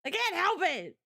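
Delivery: tag mock-helpless whine. Mock-defending herself when teased.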